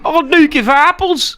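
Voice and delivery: very high, hammed-up Scottish accent; mock Scottish accent. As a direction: pitch a silly Scottish accent way up. Mocking the Scottish coworker's speech.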